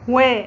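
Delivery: و said as wa with open fatha, loud; letter waw. Shown as a demonstration. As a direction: call out و with a clear open a. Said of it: "حرف الواو محرك بحركة الفتح ينطق و". (نطق الواو المفتوحة بصوت عالٍ)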